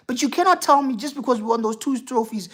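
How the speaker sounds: normal talking voice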